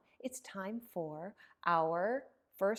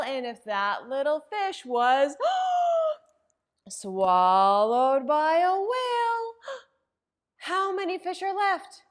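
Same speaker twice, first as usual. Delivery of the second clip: gasping, much higher and louder whale drama; dramatic gasp; 5 semitones higher, 11 dB louder than usual. Acted. Librarian acting shocked as the whale swallows a fish, gasping. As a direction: make the kids jump at the whale.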